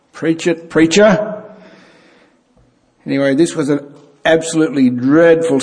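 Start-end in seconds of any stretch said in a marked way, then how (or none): 0.1-1.4 mock congregation call-out. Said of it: "people call out and coach the preacher".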